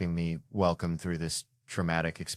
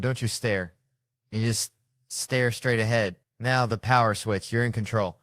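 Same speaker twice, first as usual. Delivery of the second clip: ordinary talk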